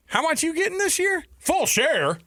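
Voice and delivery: very high mock Southern voice; Southern accent. Big school questioning Maryland's share, mock Southern accent. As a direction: needle them in a squeaky Southern accent. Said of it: "give them a Southern accent".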